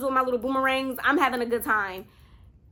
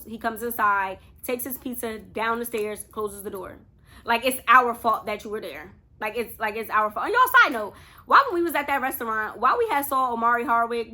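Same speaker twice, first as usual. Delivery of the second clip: usual speaking voice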